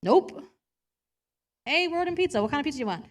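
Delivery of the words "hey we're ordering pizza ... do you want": friend asking casually. Retold or imitated. Friends asking about pizza.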